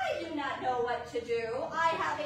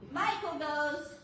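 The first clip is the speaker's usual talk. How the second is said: ordinary talk, nothing marked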